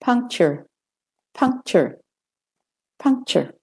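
"puncture" with American r ending, high then falling; American er closing "puncture". Shown as a demonstration. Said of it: "And the American".

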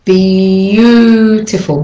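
Beautiful as b-YOOO-tiful, very slow; stretched y glide. Shown as a demonstration. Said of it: "Practice with me".

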